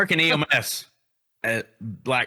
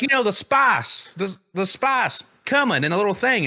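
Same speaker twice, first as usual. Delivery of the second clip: exasperated explaining; 7 semitones higher than usual. Retold or imitated. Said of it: "I was like".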